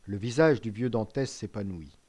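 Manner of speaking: ordinary delivery, nothing marked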